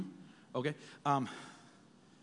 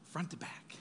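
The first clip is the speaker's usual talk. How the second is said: breathy whisper on the last words; whispering to stress front to back; 9 dB quieter than usual. Acted. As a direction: drop to a hushed whisper for effect. Whispered emphasis in sermon.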